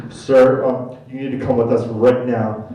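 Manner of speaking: low, loud firm order; mock security guard; stern guard. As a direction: command him to come along.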